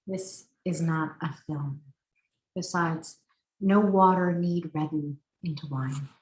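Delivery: measured poem reading with long pauses between lines; deliberate, spaced-out poet's delivery; poetry reading. Acted. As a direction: read each line as verse, letting it settle. Reads a poem aloud.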